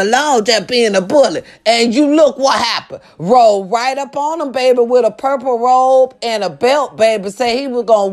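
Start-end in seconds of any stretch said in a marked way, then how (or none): none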